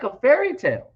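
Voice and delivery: retold incredulity. Retelling his reply to a man's dismissal, incredulous. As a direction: throw the words back, mildly incredulous.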